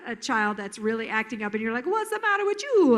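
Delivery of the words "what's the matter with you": high, loopy silly voice; funny voice. Shown as a demonstration. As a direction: pitch it high and goofy to defuse. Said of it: "to talk in a funny voice".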